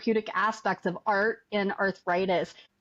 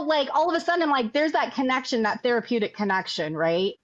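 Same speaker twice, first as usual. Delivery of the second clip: ordinary talk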